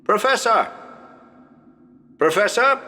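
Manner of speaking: calling a name high across the house; calling the professor from the doorway. Acted. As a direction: call out for the professor, rising to a question. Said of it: "go to the door and shout to the professor".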